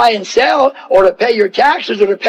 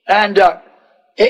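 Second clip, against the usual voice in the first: usual speaking voice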